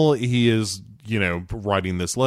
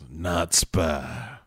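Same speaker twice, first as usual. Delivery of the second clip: low, softer put-on pronouncement; mock verdict voice; 4 semitones lower, 6 dB quieter than usual. Repeating Henry's classification of people, low mock verdict.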